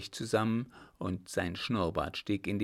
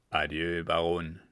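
a lower, curt "Adieu"; the captain's lower, curt "Adieu, Baron" as the baron leaves; low curt farewell; 4 semitones lower than usual. Acted. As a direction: drop the voice, brusque goodbye.

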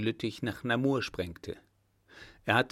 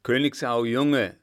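loud, delighted greeting; delighted call; 6 dB louder than usual. A narrator's reading. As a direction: call out to a welcome arrival. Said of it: "höchst erfreut ... rief er". (hocherfreut gerufen)

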